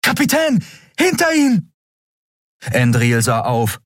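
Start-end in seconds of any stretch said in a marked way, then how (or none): 0.0-1.7 high, piercing yell to the captain; a boy screaming a warning; warning shout. A narrator's reading. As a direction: scream a warning across the room. Miko warns the captain.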